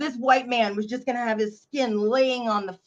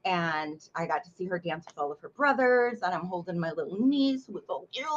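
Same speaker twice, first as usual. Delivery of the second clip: ordinary talk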